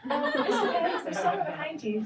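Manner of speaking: high, odd parrot voice. Acted. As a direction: pipe up like a parrot at the back.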